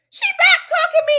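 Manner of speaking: very high, loud squawk of disbelief; incredulous high-pitched yell; mock outrage. Real, not acted. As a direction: shout it high, playfully appalled. Exclaims that dog talks back.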